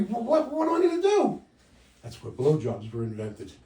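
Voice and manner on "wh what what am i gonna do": high, loud panicked stammer; panicky worry. Acted. Couple panics over a broken condom, high stammering fright.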